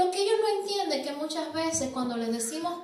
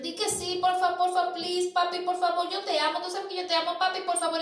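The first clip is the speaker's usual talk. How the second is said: put-on higher pleading child voice, nagging; begging child; 5 semitones higher, faster than usual. Preacher imitates a child begging his father, higher and pleading. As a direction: beg like a kid pestering his dad. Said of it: "Insisten, insisten".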